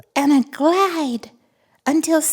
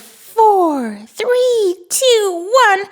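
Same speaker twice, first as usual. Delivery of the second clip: usual speaking voice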